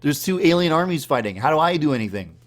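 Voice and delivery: loud, high, fast fluster; panicked confusion. Retold or imitated. Dreamer dropped into a war, loud fast panic. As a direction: blurt out the panic, lost and overwhelmed. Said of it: "but I'm like".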